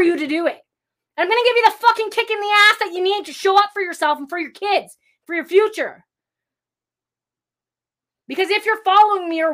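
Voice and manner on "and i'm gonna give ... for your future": fired-up shout. Real, not acted. Fiery motivational kick speech.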